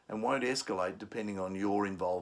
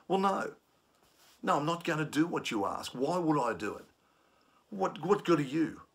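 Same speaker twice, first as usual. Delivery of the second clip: high, raised refusal; defiant challenge; 6 semitones higher, 3 dB louder than usual. Shown as a demonstration. Trainer acting a passenger refusing staff defiantly. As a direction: refuse them with scorn. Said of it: "if it starts to become challenging".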